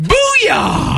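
high whooping booyah; triumphant shout. Real, not acted. Co-host celebrating his birthday remembered, whoop. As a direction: cheer it out.

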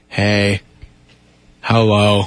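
low, flat, bored hello; low monotone greeting. Retold or imitated. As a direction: greet like a sleepy zombie. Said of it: "And you're like".

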